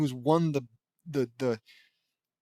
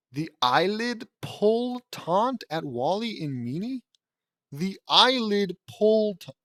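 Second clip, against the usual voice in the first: slow, higher disbelieving repeat; incredulous; 5 semitones higher, slower than usual. Real, not acted. Reader baffled by the eyelid taunt, incredulous. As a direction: draw out each word in disbelief.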